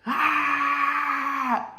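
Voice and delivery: long, very high mock war cry; Goku battle scream. Storyteller mimics opponent charging out, Goku-style yell. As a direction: imitate an anime power-up scream. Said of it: "does this fucking Goku shit".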